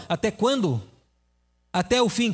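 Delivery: normal talking voice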